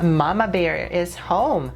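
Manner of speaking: ordinary talk, nothing marked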